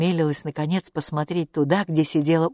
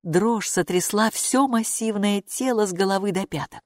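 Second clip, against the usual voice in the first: ordinary delivery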